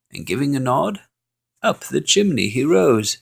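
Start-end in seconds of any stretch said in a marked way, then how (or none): none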